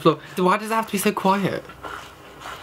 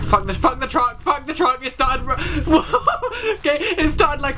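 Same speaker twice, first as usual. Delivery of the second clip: rising panic; 9 semitones higher than usual. Real, not acted. Player fleeing a truck that started, rising panic. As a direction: pitch climbing into a laughing squeal.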